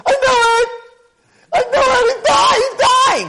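very high, loud hysterical mock shriek; panicked shrieking. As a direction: shriek in acted alarm for a dying brother. Twin inside hears the crying.

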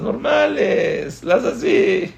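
a touch of dismay; baffled high voice. Retold or imitated. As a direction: squeak out the puzzled realisation. Helpless reaction to easy pitches.

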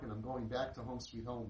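ordinary delivery

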